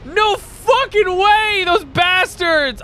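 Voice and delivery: high-pitched indignant ranting; outraged. Real, not acted. Outraged at blocked shortcut.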